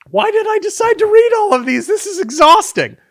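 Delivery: very high, loud, rushed whine; mock exasperation. Worn out from reading all these names, mock-exasperated. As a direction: complain at a squeaky pitch, hammed-up.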